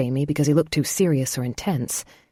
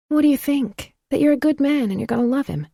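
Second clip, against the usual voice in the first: much higher, bright woman's voice; Phoebe, teasing; 10 semitones higher than usual. A narrator's reading. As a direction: lift it up, playfully certain.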